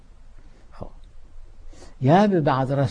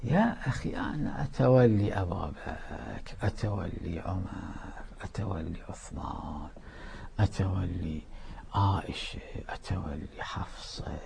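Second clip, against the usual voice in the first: softer and softer till a whisper on حفصة; fading mock voice; 6 semitones lower, 11 dB quieter than usual. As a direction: let the imagined voice die away to a whisper. Voicing a doubter, fading out.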